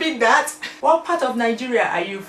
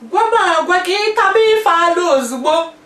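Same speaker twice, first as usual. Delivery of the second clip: Igbo line breaking into a laugh, much higher and louder; 11 semitones higher, 9 dB louder than usual. Shown as a demonstration. Finishing her Igbo demo laughing, much higher and louder. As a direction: laugh through the order. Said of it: "Do you speak your language?".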